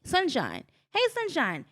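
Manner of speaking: high, lively call of a greeting. Retold or imitated. Fans greet her by name on tour, calling out.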